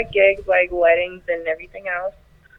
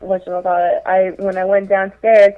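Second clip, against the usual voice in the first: normal talking voice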